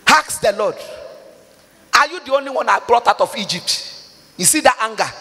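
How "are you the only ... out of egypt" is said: normal talking voice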